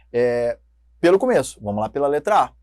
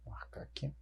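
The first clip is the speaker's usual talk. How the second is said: whispered aside to herself; 25 dB quieter than usual. Real, not acted. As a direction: breathe it out, no voice. Aside while marking answer.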